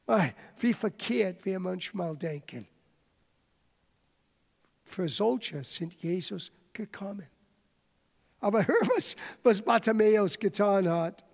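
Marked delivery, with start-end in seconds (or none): 4.9-7.3 soft whisper; whispered aside to the congregation. Real, not acted. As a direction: lean in and whisper it. Preacher drops to a whisper.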